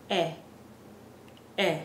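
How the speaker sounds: E as a crisp "eh", repeated after a pause; Spanish E vowel. Shown as a demonstration. Teacher showing the Spanish vowel, E said as "eh". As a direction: say E as the short "eh" in best. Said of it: "Our E in Spanish is pronounced like".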